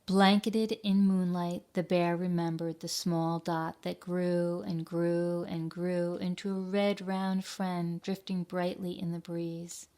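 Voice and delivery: gentle storybook reading voice; story narration. A narrator's reading. Teacher reading a picture book to children, gentle narration. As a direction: read it like a bedtime tale to kids.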